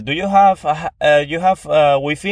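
unsure tourist asking in broken English; clumsy Spanish English. Retold or imitated. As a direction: fumble through a question in bad English. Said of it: "tú te vas a Inglaterra".

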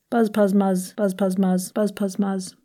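rapid-fire monotone triplets; fast, even articulation exercise; quick drill. Shown as a demonstration. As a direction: rattle off each set of three. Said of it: "Do those quickly".

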